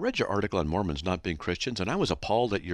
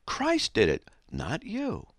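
normal talking voice

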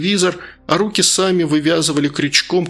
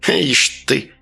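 put-on old woman whispering. A narrator's reading. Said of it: "прошептала бабушка".

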